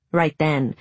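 usual speaking voice

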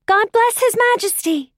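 high, loud crowd cheer; ringing high-pitched acclamation; shouting. A narrator's reading. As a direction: shout it out high like cheering children lining a road. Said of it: "they shouted".

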